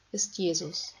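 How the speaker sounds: plain reading voice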